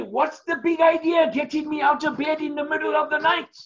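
high, fast mock shout of a woken farmer; imagined angry farmer. Preacher acting the furious farmer the salesman imagines. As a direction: bark it like a grumpy man dragged from bed. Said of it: "He would say".

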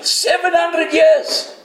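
high, slow emphatic exclamation; high-pitched preacher's amazement, every word stretched; amazed exclamation. Real, not acted. Exclaiming over the prophecy's age.

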